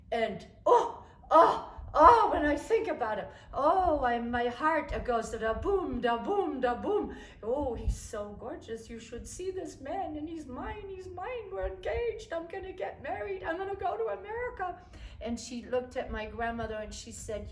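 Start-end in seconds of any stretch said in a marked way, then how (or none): 0.1-7.2 loud, high, giddy swooning; lovestruck gush. Retold or imitated. Gushing about her fiancé. 7.4-14.8 higher, rising gleeful boasting; giddy bragging. Retold or imitated. Bragging about fiancé.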